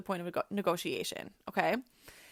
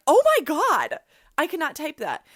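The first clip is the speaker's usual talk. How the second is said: loud, higher shocked cry of disbelief; shocked outburst; 5 semitones higher, 12 dB louder than usual. Retold or imitated. Retelling her horror at her brother's request, loud shocked cry. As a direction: blurt it out, scandalised and laughing.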